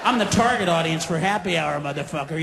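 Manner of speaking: low, weary barfly; mock husband who wants a drink. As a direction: grumble it like a tired husband. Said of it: "He has that look like".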